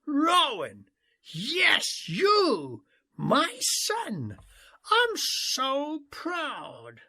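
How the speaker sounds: high calls, the last words slow; father calling proudly to his son across the river. A narrator's reading. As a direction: shout pride across the water. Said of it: "I roared across the distance".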